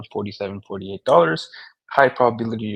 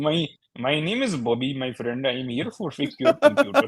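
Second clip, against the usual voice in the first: higher mock Indian accent; 3 semitones higher than usual. Posing as tech support, mock Indian accent. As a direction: play an Indian-accented computer repairman. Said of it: "You guys do 'em great".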